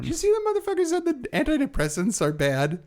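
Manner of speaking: high incredulous squeal; outraged. Real, not acted. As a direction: pitch it up in disbelief. Outraged question.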